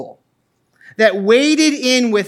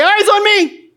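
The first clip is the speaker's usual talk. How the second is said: high, loud call of "Eyes on me!"; instructor's shout; 9 semitones higher, 3 dB louder than usual. Retold or imitated. Karate instructor calling his class to attention, loud shout. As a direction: bark it like a karate teacher at the front. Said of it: "And he'd say".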